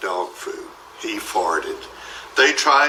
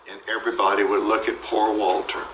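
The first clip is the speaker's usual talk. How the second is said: ordinary delivery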